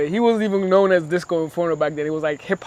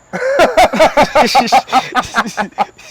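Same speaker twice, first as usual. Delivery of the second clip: big loud burst of laughter; 7 semitones higher, 6 dB louder than usual. Real, not acted. Laughing at the bad show.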